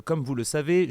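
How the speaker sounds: ordinary talk, nothing marked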